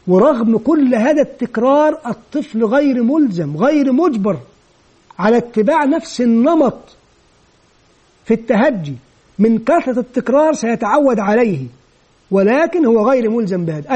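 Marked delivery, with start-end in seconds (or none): none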